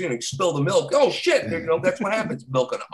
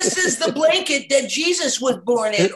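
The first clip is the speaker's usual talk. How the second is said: hammed-up seller; 7 semitones higher than usual. Pawn shop seller voice.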